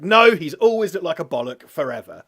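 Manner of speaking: loud, high, emphatic protest; mock insistence. Host overruling doubts about the actor's looks, loud mock insistence. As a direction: insist on it at full volume, comically.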